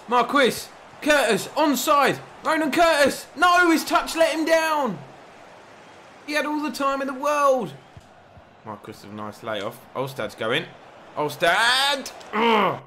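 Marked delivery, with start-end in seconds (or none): 0.1-7.7 excited shouting. Real, not acted. Chance missed, live shouting. 11.2-12.8 frustrated cry. Real, not acted. Chance missed, frustration.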